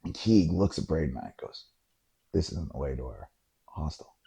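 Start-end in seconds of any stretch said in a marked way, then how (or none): 2.3-4.1 very low, hushed voice; quiet observation. Retold or imitated. Friend quietly flags the wrong turn to his companions, hushed. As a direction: say it under your breath, much lower.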